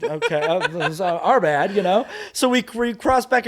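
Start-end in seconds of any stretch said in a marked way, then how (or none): 0.0-1.8 sheepish laughing apology. Retold or imitated. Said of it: "we were all like".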